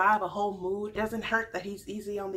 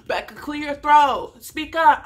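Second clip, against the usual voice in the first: mock demand; 5 semitones higher, 10 dB louder than usual. Acts out Blake correcting Becca.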